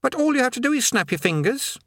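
put-on high young boy's voice; lightly high-pitched youth voice; boy Eric.

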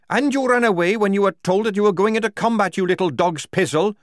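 loud, high tirade; angry accusation. Acted. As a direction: hurl the insult at full voice. Tonker insults Strappy.

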